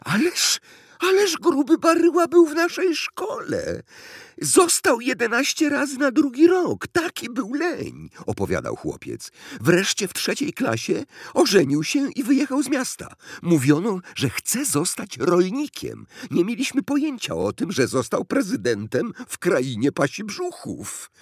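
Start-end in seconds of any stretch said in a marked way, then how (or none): 0.0-8.1 put-on very high, loud boyish voice; excited boy. A narrator's reading. Boy tells his uncle about an old schoolmate, excited. 9.6-21.1 put-on very high, loud boyish voice; excited boy. A narrator's reading. Boy tells his uncle about an old schoolmate, excited.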